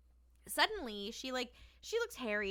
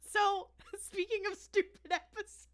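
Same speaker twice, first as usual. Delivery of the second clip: giggling through the words, pitched up; can't get it out for laughing; 9 semitones higher, 4 dB louder than usual. Real, not acted. Host laughing while introducing the stupid episode.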